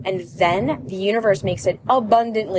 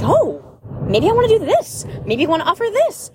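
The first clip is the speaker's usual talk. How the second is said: higher, airy, toying with ideas; mock light-hearted musing; playful wondering; 9 semitones higher than usual. Imagining a client-free business owner, playfully musing. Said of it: "You're like".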